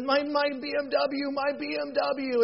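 mock lament, stretched and high-pitched; distraught wail. Banker still mourning his car over the ravine, mock-distraught wail. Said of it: "over the ravine and said".

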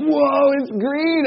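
high-pitched, amazed re-enactment; childlike wonder. Retold or imitated. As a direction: marvel like a kid at a magic trick. Re-enacts childhood delight at mixing colors.

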